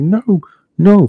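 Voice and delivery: high, loud struggling cry; mock panicked plea. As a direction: plead as you're dragged away. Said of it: "he's like".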